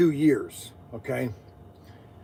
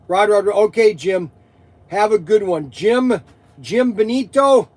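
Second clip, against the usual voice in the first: calling out; 5 semitones higher, 8 dB louder than usual. Real, not acted. Calling out to stream viewers.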